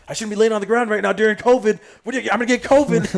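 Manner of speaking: loud, high, fast mock panic; comic freak-out. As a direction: blurt it out in comic alarm. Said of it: "I'm freaking out".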